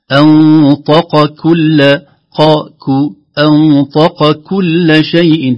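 "أنطق كل" slow, heavy q then light k; qaf then kaf. Shown as a demonstration. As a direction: keep "أنطق كل" q and k distinct. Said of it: "جاء القاف بعدها الكاف". ("أنطق كل" بقاف مفخمة وكاف مرققة)

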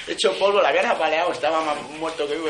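high, loud laughing retelling. Real, not acted. Telling the audience about a beaten friend, laughing loudly. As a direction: laugh through it in disbelief.

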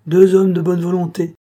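high, loud call to the troops. A narrator's reading. Officer turning to the guard post, calling out. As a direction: call out for volunteers.

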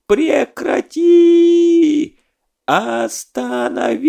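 shrill high-pitched yelp; squeaky cry. Acted. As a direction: squeal it like a piglet over the storm. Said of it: "крик Задова, больше похожий на писк".

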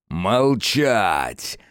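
demon lord barking an order; angry bark. Acted. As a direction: snap the command. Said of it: "рявкнул повелитель тьмы".

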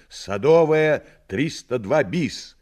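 short loud shout into phone. Acted. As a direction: call out the address urgently. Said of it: "коротко крикнуть в трубку".

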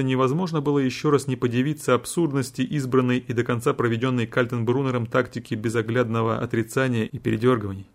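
usual speaking voice

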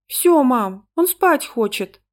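put-on high, slow little-girl voice; high-pitched child reporting to mum; contented child. A narrator's reading. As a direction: announce it like a small daughter. Child reports to her mother.

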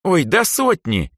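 high, loud, drawn-out exclamation; exclaiming girl. A narrator's reading. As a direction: wave it off in a high girl's voice. Elsa exclaims, waving it off.

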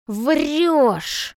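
high, drawn-out accusation; angry shout. A narrator's reading. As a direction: hurl the accusation out. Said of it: "кричит мать".

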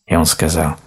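usual speaking voice

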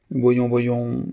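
put-on young woman mildly annoyed at the teasing. A narrator's reading. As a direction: brush it off with mild irritation. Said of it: "fit, ennuyée".

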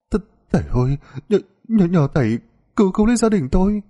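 high, stuttering plea for help; stammering in fear. A narrator's reading. Second wife begging the sorcerer for help, stammering in fear. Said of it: "bà lắp bắp".

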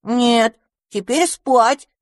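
firm, slow stern parent. Acted. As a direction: lay down the law, firm. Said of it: "строго сказал одуванчик".

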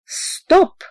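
a high, sudden stop order; sharp command. A narrator's reading. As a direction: cut everyone off with one command. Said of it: "скомандовал Иннокентий Филатыч".

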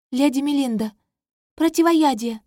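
high, loud command; urgent call. Acted. Princess calls for antidote.

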